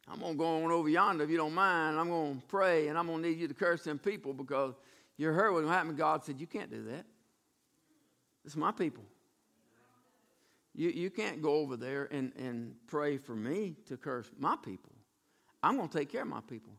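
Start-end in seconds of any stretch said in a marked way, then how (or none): 0.1-5.9 lightly voiced Balaam, low and offhand; quick, lower, folksy asking; Balaam bargaining. Balaam casually telling God his plans, low and offhand. 6.4-16.7 low, soft, measured refusal; quiet, deep authority, unhurried; God. Acted. God quietly forbidding Balaam to curse his people, low and firm.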